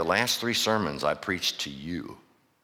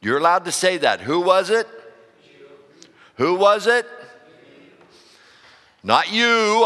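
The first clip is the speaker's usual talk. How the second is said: preaching higher and louder, building to a call; rising preaching; 9 semitones higher, 6 dB louder than usual. Real, not acted. Pastor calling his church to answer, rising preaching. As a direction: rouse the room, louder with each line.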